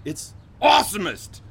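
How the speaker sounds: loud booming announcer shout; monster truck ad. Acted. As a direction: hype it like a monster truck rally ad. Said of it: "monster truck announcer".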